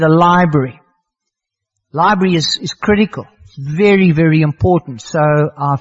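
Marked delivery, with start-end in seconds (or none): none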